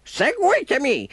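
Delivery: high croaking bird voice. A narrator's reading. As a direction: caw it out like a bird. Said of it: "gracchiò l'uccello nero".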